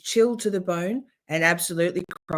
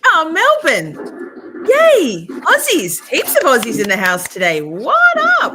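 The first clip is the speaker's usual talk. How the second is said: excited greeting; 10 semitones higher, 10 dB louder than usual. Real, not acted. Greeting Australian viewers excitedly.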